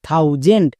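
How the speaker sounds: a teacher blending syllables for pupils; slow syllable blending. Shown as a demonstration. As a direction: slowly join জেন্ড onto থাউ to make thousand. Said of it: "যেমন T H O ইউ".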